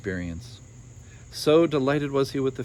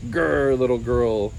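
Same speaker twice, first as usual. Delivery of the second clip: louder ogre growl; 4 dB louder, slower than usual. Acted. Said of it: "growled the ogre".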